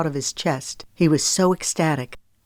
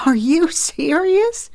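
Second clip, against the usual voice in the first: high, slow, disbelieving rise; laughing disbelief; incredulous laugh; 12 semitones higher, slower than usual. A narrator's reading. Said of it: "laughter from rising in her throat".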